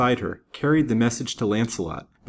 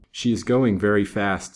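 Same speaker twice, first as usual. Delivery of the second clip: plain reading voice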